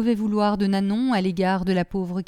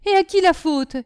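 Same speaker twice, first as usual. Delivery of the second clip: shrill, sour retort; high, loud, acid-voiced accusation; sour cry; 10 semitones higher, 6 dB louder than usual. A narrator's reading. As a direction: throw the blame back, high and loud. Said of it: "cria d'une voix aigre".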